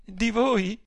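high-pitched, scoffing question; amused disbelief. A narrator's reading. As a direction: toss it back in amused disbelief. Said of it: "Fulvia scoppiò a ridere".

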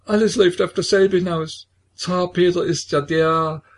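a frantic possessed woman's revelation. A narrator's reading. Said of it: "Kilikea die Besessene".

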